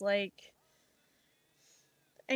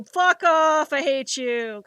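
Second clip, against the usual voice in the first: loud mock snap at someone; hammed-up irritated outburst; 4 semitones higher, 9 dB louder, slower than usual. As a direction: bark it like a grumpy bear. Example of irritable snapping.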